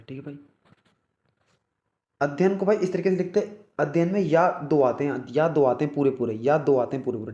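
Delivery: ordinary talk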